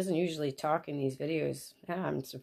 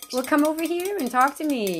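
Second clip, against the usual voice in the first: much higher, louder pet-calling voice; calling a pet over; 10 semitones higher, 9 dB louder than usual. Real, not acted. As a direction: coax a bird over in a high voice. Calls the bird over.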